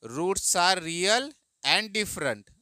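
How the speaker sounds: very slow, every word spaced out; slow dictation. Shown as a demonstration. Teacher dictating the answer line to students. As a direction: spell it out slowly for the class to copy. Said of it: "तो क्या लिखेंगे?".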